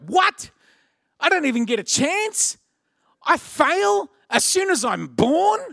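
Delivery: high, incredulous questioning; retold outrage, climbing high on each question; mock indignant disbelief. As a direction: protest the unfairness, voice pitched right up. Retells indignant first reaction.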